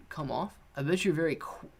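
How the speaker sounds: ordinary delivery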